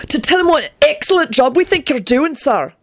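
high, eager-to-please tone; keen earnest flattery. A narrator's reading. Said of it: "Benji put in earnestly".

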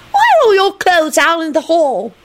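put-on high childish curiosity; beaming little boy. A narrator's reading. Teddy questions Neville about his clothes, high cheery voice. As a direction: ask in a high, happy child's voice. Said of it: "he beamed".

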